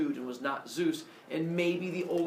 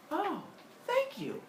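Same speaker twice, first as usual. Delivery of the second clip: much higher, gushing thanks; gullible Semele; slower than usual. Acted. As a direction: gush like a naive girl.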